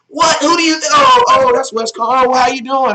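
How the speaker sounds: high, loud outraged "what?" that flips into a sheepish greeting; mock outraged then surprised. Chat commenter recognizing the host, mock indignant to sheepish. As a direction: act the commenter caught out, indignant then backpedalling. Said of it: "And then they'll be like".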